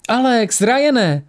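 high-pitched happy call; joyful cry. A narrator's reading. Woman greets arriving friends, joyful cry. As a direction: call out their names in delight, pitch soaring. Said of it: "ozval se radostný výkřik".

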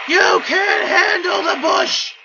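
cocky gloating. Real, not acted. Gamer trash-talking enemies while winning, cocky shouts. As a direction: gloat over the enemies you're beating.

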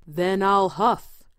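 slow, loud wolf voice; roaring wolf. A narrator's reading. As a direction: huff and puff it out slowly. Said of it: "roared the wolf".

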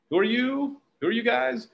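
bright high greeting. Retold or imitated. Greeting strangers at event.

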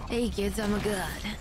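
a hint of a shonen hero. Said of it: "shonen voice".

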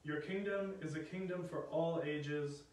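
ordinary delivery

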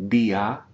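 Dia as di-a in two syllables. Shown as a demonstration. Teacher models Dia for students, two syllables. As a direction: split Dia into two beats. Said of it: "two distinct syllables".

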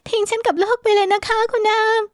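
high-pitched woman's voice, pleading; pleading heroine. Acted. Heroine pleads with man not to leave. (เสียงหญิงสาวสูง อ้อนวอน)

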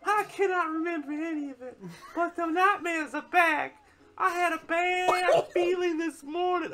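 very slow, high, drawn-out character voice; high-voiced odd villager. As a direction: stretch each word out, pitched high.

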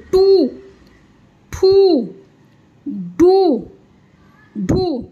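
टू, ठू, डू, ढू each with a long held "oo"; drawn-out ऊ. Shown as a demonstration. Teacher showing learners the ऊ sound on टू, ठू, डू, ढू, long drawn-out vowels. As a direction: stretch the vowel in टू, ठू, डू, ढू. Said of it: "ऊ के sound को मिला के आप बोलते जाएंगे".

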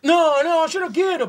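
high, loud protest; alarmed refusal. Real, not acted. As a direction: refuse loudly before being made to taste it. Refuses to taste the candy.

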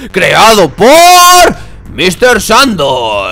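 slow announcement. Acted. Dramatic slow creator credit.

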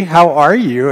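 usual speaking voice

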